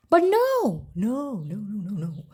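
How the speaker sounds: exasperated repeated no; emphatic no. Real, not acted. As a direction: shut the idea down hard, then let it fade. Emphatic denial in a vlog rant.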